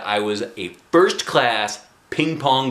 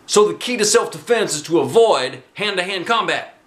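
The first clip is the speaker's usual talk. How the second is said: high, louder coach voice; 8 semitones higher, 4 dB louder than usual. Acted. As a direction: sell it like a gung-ho coach. Teacher lecturing class.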